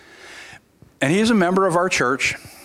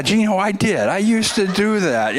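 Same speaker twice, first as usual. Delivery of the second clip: sheepish admission; 5 semitones higher than usual. Retold or imitated. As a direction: own the past, a little awkward. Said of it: "And I said".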